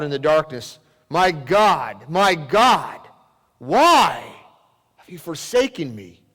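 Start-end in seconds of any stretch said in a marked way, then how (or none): 1.1-6.2 loud, anguished outcry to God; anguished cry. Acted. Jesus crying out to God from the cross, anguished. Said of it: "we hear the sound of anguish in Jesus' voice when he cries out".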